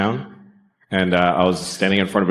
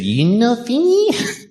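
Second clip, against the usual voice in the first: put-on high, slow Japanese accent on "You know Finnish?"; 13 semitones higher, slower than usual. Imitating the Japanese waitress asking about his plate, high and slow. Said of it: "exactly what she said".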